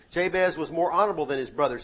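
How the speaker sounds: ordinary talk, nothing marked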